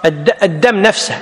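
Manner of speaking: ordinary delivery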